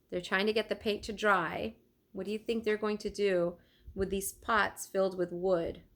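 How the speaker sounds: plain reading voice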